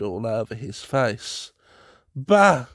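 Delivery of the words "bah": loud, high dismissive scoff; scoffing bah. A narrator's reading. Tutor mocking the Commodore's fear, loud scoffing bah. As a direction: brush the fear aside. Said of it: "said my tutor".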